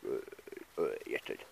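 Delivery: low, whispered voice for the dog Baldrick; dog's voice. Pretend. Owner answers for his dog.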